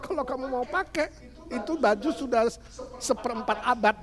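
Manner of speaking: high, light voice for the mother; mother's reply. Acted. Preacher voicing Betty's mother answering her, teasing and high. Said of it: "mamanya mengatakan".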